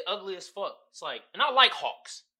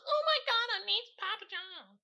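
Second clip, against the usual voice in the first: mock pizza fan; 12 semitones higher than usual.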